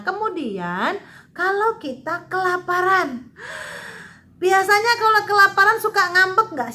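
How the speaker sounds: ordinary delivery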